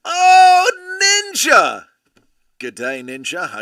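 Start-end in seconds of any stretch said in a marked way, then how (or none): 0.1-1.9 loud, high delighted call of a name; excited greeting. Real, not acted. Streamer greeting a favorite viewer, loud and excited.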